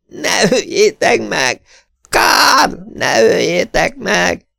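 high, slow, pleading cries; desperate begging. A narrator's reading. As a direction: beg them through the door not to kill him. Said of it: "és könyörög". (a nő kétségbeesetten könyörög az ajtón át)

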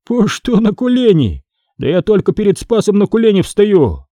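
high-pitched, defiant full voice; undimmed voice. Acted. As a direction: answer proudly without lowering your voice. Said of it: "не понизив голоса, ответил". (дерзко, не понижая голоса)

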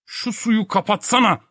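very high, exasperated order; exasperated yell. Acted. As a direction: order it at the end of your patience. Professor beside himself, orders water shut off. (çileden çıkmış, tiz bağırış)

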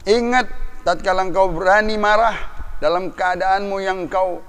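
loud and high, warning the proud; preaching at full force. Acted. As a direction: thunder a warning at the powerful. (khotbah berapi-api, keras dan tinggi)

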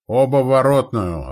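loud, high spotter's report; observer calling across the position; called-out reply. A narrator's reading. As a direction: shout the hit back to the commander. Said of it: "откликнулся наблюдатель".

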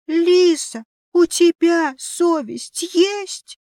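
высокий, громкий, нарочито медленный укор; воскликнуть как наигрывающий трагик на сцене; театральный возглас. Acted. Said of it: "театрально воскликнул". (театрально, с надрывом)

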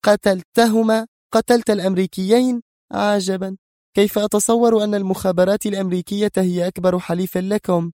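high-pitched, indignant woman's outburst; angry. Acted. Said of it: "قالت نادية في غضب". (بغضب واستنكار)

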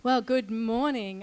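ordinary talk

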